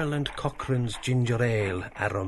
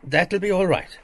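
firm; 9 semitones higher, 9 dB louder than usual. A narrator's reading. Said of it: "answered firmly".

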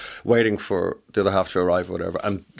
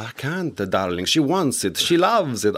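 bad Italian accent; 3 semitones higher, slower than usual. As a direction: do a bad Italian-accented impression of your partner. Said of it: "that's a very bad impression of him".

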